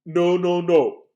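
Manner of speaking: loud lion voice; the lion booms his objection; lion's voice. Acted. Lion rejects antlers, loud and booming. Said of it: "said the lion".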